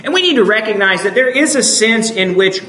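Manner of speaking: normal talking voice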